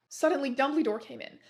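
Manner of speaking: usual speaking voice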